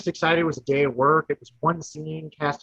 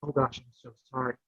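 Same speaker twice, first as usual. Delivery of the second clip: sheepish apology; 3 semitones lower, 7 dB quieter than usual. Retold or imitated. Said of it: "And I said".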